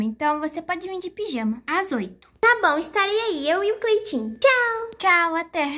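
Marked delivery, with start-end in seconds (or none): 2.4-5.7 put-on high childish voice on the phone; high, chirpy kid voice; little girl voice. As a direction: play a cheerful little girl answering a call. Doll says goodbye.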